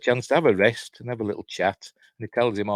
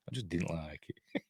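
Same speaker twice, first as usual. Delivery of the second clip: quiet aside; 5 semitones lower, 14 dB quieter than usual. Real, not acted. Muttered confession after praising film.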